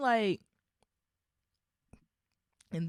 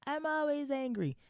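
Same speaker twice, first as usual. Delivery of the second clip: Bruce Banner impression; 11 semitones higher than usual. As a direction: quote the Hulk's secret lightly, up high. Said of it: "in the first Avenger, he's like".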